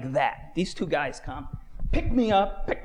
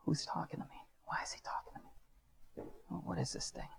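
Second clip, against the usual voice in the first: hushed, whispered questioning; private wondering; 18 dB quieter than usual. Retold or imitated. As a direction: puzzle it out under your breath. Puzzling privately over the voice.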